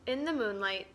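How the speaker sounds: usual speaking voice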